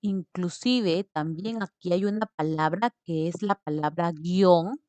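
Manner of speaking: ordinary delivery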